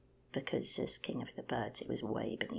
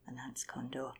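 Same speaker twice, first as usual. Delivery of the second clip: usual speaking voice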